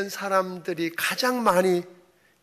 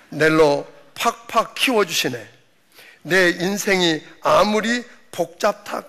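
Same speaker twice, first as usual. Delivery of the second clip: Gyeongsang dialect. Pretend. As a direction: read the psalm like a blunt Gyeongsang local. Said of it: "경상도 버전을 제가 읽어드리겠습니다".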